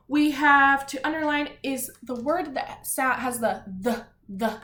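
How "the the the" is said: "the" said as an isolated opening sound; beginning sound. Shown as a demonstration. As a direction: repeat "the" as a separate th sound. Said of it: "sound at the beginning".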